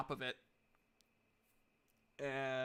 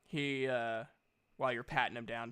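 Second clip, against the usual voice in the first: ordinary talk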